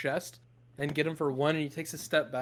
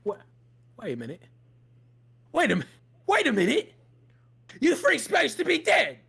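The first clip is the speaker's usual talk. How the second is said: the guard's disbelief swelling into alarm; startled guard; 9 semitones higher, 5 dB louder than usual. Acted. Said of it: "What happened to your voice?".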